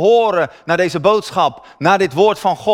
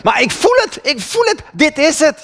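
much higher, eager and insistent; ecstatic conviction; 10 semitones higher than usual. Retold or imitated. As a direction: burst with certainty. Ecstatic young convert retold. (veel hoger, vurig en overtuigd: ik voel het)

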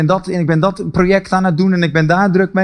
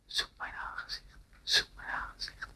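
soft breathy whisper, calling again and again; God calling in the heart, a hushed whisper; 23 dB quieter than usual. Acted. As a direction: whisper it like an inner call from God. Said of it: "Hij hoorde in zijn hart".

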